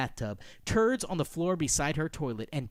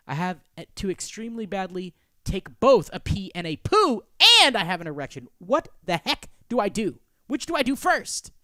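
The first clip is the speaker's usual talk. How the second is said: higher, louder, building panic; frantic exasperation; 4 semitones higher, 8 dB louder than usual. Acted. Acted panic over toilet predicament.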